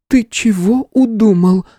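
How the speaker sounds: high, slow, still a little shaky; wary girl, barely stammering now; young woman voice. Acted. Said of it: "почти не заикаясь, спросила Стелла".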